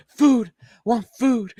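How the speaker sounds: put-on high, slow baby creature voice; hungry owlbear cub. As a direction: play a dumb hungry cub. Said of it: "He's just like".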